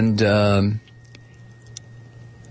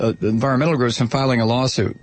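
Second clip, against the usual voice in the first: normal talking voice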